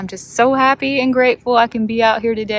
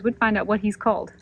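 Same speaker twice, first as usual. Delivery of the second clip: normal talking voice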